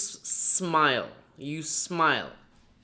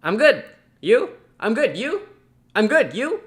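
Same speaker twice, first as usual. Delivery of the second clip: loud, high, mechanical repeated answer; bad rote reply; 7 semitones higher, 9 dB louder than usual. Shown as a demonstration. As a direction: parrot it like a bored robot. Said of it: "Do not just say".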